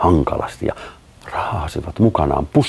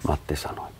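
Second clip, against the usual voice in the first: ordinary delivery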